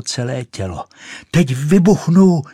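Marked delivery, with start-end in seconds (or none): 1.3-2.4 high, loud announcement; loud triumphant warning; shouting. A narrator's reading. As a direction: burst out at full pitch. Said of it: "křičela".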